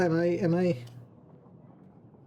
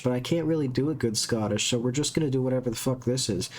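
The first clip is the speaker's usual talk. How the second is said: normal talking voice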